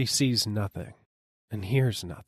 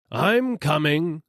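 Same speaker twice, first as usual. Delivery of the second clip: higher, slow, loud reply; eager dealer; 7 semitones higher, 5 dB louder, slower than usual. A narrator's reading. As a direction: promise to come. Dealer answers.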